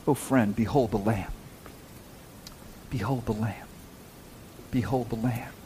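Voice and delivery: soft, low plea to the congregation; softly awed, leaving space after each phrase; hushed reverence. Real, not acted. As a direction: let it sink to a reverent hush. Hushed appeal to contemplate the crucifixion.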